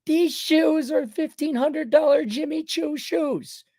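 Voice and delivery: high, slow, drawn-out mock boasting; middle-aged woman. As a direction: show off the shoes in a mocking woman's voice. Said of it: "these middle-aged women".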